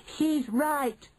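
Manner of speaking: high, excited witch; crying out. Acted. Another witch backs Mildred, high excited cry. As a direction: cry agreement at a high pitch. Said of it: "cried another voice".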